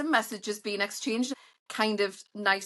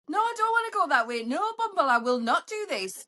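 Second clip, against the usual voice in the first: mock refusal; 7 semitones higher than usual. Mock arguing with Bumble.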